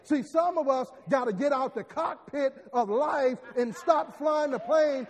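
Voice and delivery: loud, climbing shouted sermon; whooping preacher climax; preaching at full force. Acted. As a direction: preach it to the rafters.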